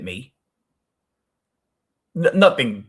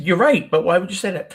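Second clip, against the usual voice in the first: higher and louder, pressing why; puzzled insistence; 6 semitones higher, 6 dB louder than usual. Retold or imitated. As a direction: push the question again, baffled. Pressing him again.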